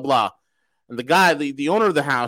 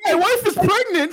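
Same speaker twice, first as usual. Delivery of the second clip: laughing mockery; 12 semitones higher than usual. Host mocking agent, laughing.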